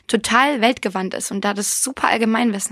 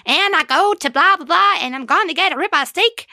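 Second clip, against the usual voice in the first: mocking fake English; 7 semitones higher, 3 dB louder than usual. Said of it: "versucht, mit mir Englisch zu reden".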